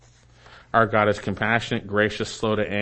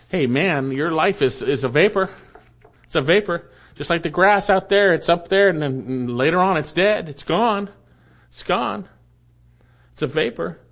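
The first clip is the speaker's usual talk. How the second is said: high, urgent preaching; impassioned preaching; 7 semitones higher, 5 dB louder than usual. Real, not acted. Preacher warning the congregation that life is a vapor, impassioned. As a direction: press it home with high, punchy phrases. Preacher presses the point home.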